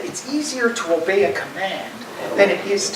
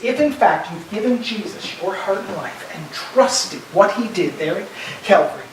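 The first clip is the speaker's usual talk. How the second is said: ordinary delivery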